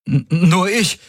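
high, nervous stutter; stammering. A narrator's reading. Valyr answers the guard captain's question, stammering. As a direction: stumble on the first sound, voice up. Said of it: "stammelte Valyr".

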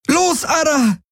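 very high, desperate cry; desperate scream. A narrator's reading. Kirai to the frozen Ara as riders storm in, desperate scream. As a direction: scream for the animal to move. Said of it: "schrie Kirai verzweifelt".

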